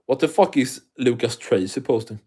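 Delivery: ordinary talk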